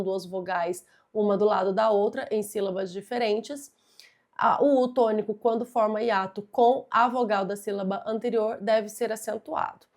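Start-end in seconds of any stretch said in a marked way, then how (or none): none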